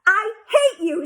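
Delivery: put-on shrill, loud child's yell; furious girl yelling. A narrator's reading. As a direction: yell it at them all. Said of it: "she yelled".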